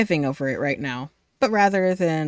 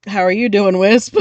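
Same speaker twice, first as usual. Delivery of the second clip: loud greeting; 3 semitones higher, 8 dB louder than usual. Real, not acted. Greeting game character.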